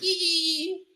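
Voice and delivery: high held squealing "ee"; imitated break effect. Host showing a producer the break effect, high held squeal. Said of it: "break effect, no? Like, like".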